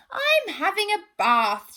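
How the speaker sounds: a bit sniffy from the bath; prim hippo. Acted.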